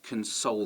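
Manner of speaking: verb "console" said con-SOLE; stress on second syllable. Shown as a demonstration. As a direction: lean on the last syllable of "console". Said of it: "is on the second syllable".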